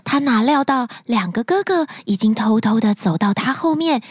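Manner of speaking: ordinary delivery, nothing marked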